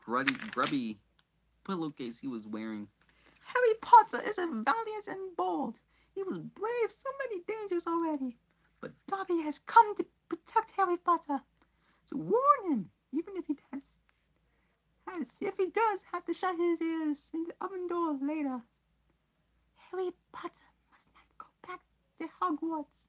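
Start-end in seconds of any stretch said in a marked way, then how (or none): 3.4-18.6 very high, loud elf voice; squeaky earnest elf; earnest house-elf. Acted. Dobby's elf voice. 19.8-23.0 very high whispered elf voice; high urgent elf whisper; whispered elf warning. Acted. Dobby's warning.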